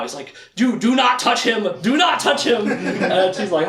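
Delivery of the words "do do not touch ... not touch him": higher, urgent warning with stress on "not"; freaking out. Retold or imitated. Recapping the show, speaker voices panicked Zed warning Kaylin. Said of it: "he's freaking out".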